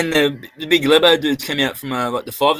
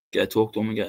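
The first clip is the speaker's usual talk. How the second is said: ordinary talk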